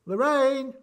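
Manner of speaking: high, loud call across the room. Real, not acted. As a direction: shout for Lorraine to answer. Calling Lorraine again, louder.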